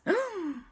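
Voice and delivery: high, sudden intake; excited gasp. Acted. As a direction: gasp at the party treats. Excited at party treats.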